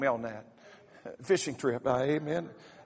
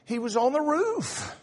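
scolding wife; 5 semitones higher, 4 dB louder than usual. Retold or imitated. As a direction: lecture him like the wife. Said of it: "She said".

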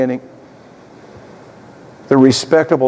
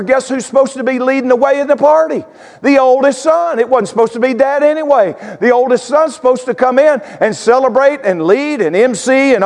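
put-on resentful older son; 10 semitones higher than usual. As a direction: list every grievance, pitched way up. Voices the older son's complaint.

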